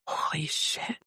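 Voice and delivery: whispered retold astonishment; stunned, under the breath; hushed amazement. Woman seeing the number on the calculator, hushed amazement.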